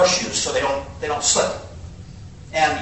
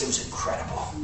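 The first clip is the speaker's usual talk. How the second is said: awed hush; 11 dB quieter than usual. Real, not acted. Four days alone in mountains.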